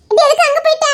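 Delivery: high, loud scolding; scolding shout. Acted. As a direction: call her out sharply. Brother scolding sister loudly.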